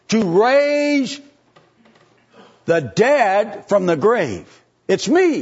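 high, loud, swelling sermon cry; preaching at full force. Acted. Preacher rallying his flock, rising to a loud high cry. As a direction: lift the congregation toward the victory.

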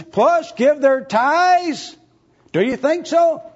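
high, loud rising preacher's questions; preaching at full force. Acted. Preacher pressing his congregation, loud rising preaching.

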